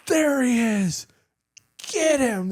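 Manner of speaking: high hollered call; mock yell. Tab Spec fours bursting in on the new guy, high yelling. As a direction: shout it like you just found your target. Soldiers find him in room.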